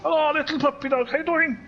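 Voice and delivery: high, playful hello to a puppy; greeting a puppy. Acted. Commentator greeting a little puppy, high playful voice.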